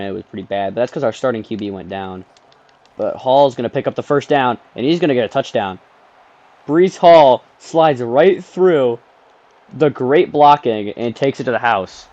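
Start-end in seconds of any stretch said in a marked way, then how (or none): 3.0-5.8 play-by-play. Pretend. Calling the play like a commentator. 6.7-9.0 excited slow call. Pretend. Commentator call of the run. 9.7-12.1 play-by-play. Pretend. Calling the play like a commentator.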